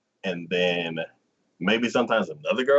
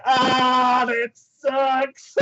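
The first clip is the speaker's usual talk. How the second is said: mock dismay; 11 semitones higher, 4 dB louder, much slower than usual. Real, not acted. Her own dismayed reaction.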